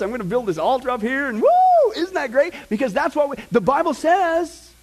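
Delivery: high, hammed-up revival fervor with whoops; rally the crowd with pretend glee; mock excitement. Acting out gleeful sacrifice plans in church.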